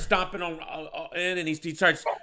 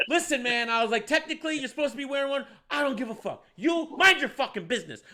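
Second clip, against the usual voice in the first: high-pitched mock squabble; retold yelling argument; 9 semitones higher than usual. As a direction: act out a heated back-and-forth. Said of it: "They're both yelling in my face".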